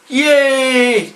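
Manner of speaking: high loud mock cheer; sarcastic yay. Real, not acted. About wearing a leg brace, a loud sarcastic cheer. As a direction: cheer with irony.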